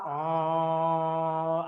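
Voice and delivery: slow uh drawn inward. Shown as a demonstration. Teacher demonstrating the inward sound. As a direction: keep the uh inside. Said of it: "Inward sound is".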